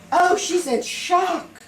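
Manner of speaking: high, loud, slow squeal of alarm; high loud mock cry of shock; mock alarmed staff. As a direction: ham up the nurses' panic. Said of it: "they said".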